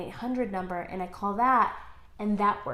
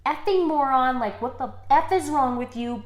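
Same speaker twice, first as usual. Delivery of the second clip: heated retold outburst, louder; mock tirade with raised voice; losing it; 2 semitones higher, 5 dB louder than usual. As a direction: re-enact blowing up at someone. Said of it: "completely losing it on this guy".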